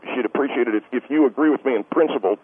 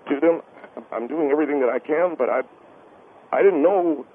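higher voice, faltering to a halt; emotional, choking up; 5 semitones higher than usual. Acted. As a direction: struggle to get the words out, close to breaking. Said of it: "I know it's emotional".

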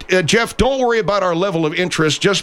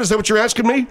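ordinary talk

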